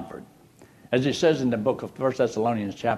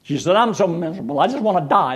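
mock despair; 7 semitones higher, 5 dB louder than usual. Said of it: "just started bawling".

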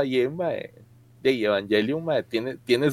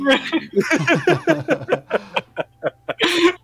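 laugh; 10 semitones higher than usual. Real, not acted. Long laugh.

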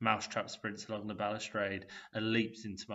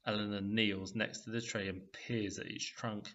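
plain reading voice

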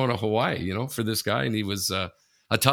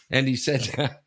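usual speaking voice

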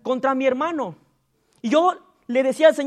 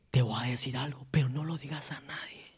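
low, soft, sugary whisper of a secret; sweet gossip voice; 12 semitones lower, 14 dB quieter, faster than usual. Retold or imitated. Pastor mimicking a gossip speaking sweetly to a brother, soft and low. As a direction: sweeten it and hush at the end. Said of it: "Hasta lo dicen con una voz dulce".

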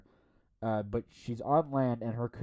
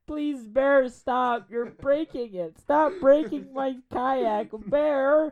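very high, loud panicked pleading; frantic begging; 11 dB louder, much slower than usual. Retold or imitated. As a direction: shriek at the bear to get off the kayak. Woman begging bear to stop.

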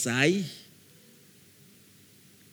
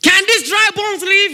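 much higher and louder "Can these dry bones live?"; booming question; 10 dB louder than usual. Acted. God challenges Ezekiel over the bones, booming and high. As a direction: thunder the question out to the crowd. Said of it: "God is training his children".

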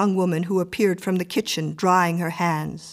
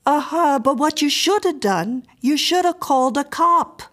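lightly voiced woman, raised and emphatic; advising neighbour; 7 semitones higher, 5 dB louder than usual. A narrator's reading. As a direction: give blunt advice, pitched up. Woman advises Mrs Miller.

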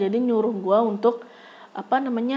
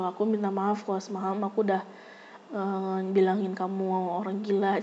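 softer, fast meek apology; 3 semitones lower, 7 dB quieter than usual. Retold or imitated. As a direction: mumble sorry sheepishly. Classmate apologizing softly. (permintaan maaf pelan)

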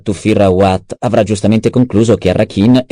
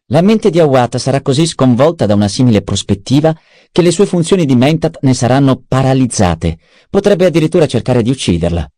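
a slightly higher, lively voice for Peter's lines; lightly voiced schemer; 7 semitones higher than usual. Adviser briefing the baron on the duke's possible escape, lightly voiced. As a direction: lay out the options with lifted pitch.

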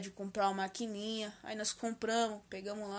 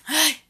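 second loud sneeze, higher cry; 6 semitones higher, 13 dB louder than usual. Real, not acted. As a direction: sneeze again sharply. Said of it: "vou espirrar".

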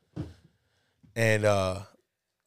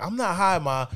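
high-pitched protest; defensive son; 7 semitones higher than usual. Retold or imitated. Son denies mom's accusation, defensive. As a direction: act out a teen pleading innocence.

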